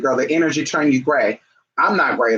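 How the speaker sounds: ordinary talk, nothing marked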